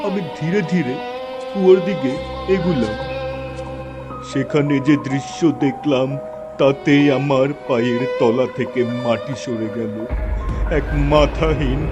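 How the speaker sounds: ordinary delivery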